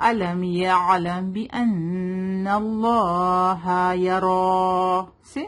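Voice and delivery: slow measured tajweed recitation; teacher's model recitation; Quranic recitation. Shown as a demonstration. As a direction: recite it slowly, drawing out the vowels.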